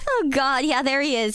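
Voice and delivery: usual speaking voice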